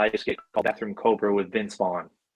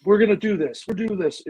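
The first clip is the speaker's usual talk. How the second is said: high, louder movie-star take-charge voice; Vince Vaughn; 10 semitones higher, 4 dB louder than usual. Retold or imitated. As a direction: take over the set, pitched up. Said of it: "he just took over".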